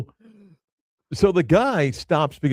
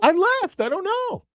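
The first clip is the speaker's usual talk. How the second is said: high-pitched defensive retort; exasperated defense. Real, not acted. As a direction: protest it in a much higher voice. Defends leaving when co-host asks.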